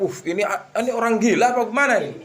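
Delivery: high, fast shocked cry; shocked neighbours. Acted. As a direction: neighbours recoil at the filthy man.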